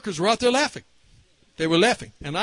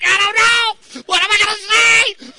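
panicked outburst; 12 semitones higher, 7 dB louder than usual. Retold or imitated. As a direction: shout out your helplessness. Acted inner panic.

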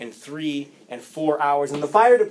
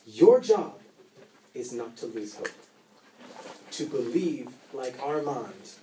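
closing sermon; 4 semitones lower, 5 dB quieter than usual. Acted.

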